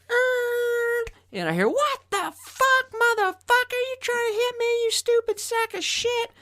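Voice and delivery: very high, screeching mock woman's yell; hammed-up high-pitched screech of an angry lady; imitated shrieking lady.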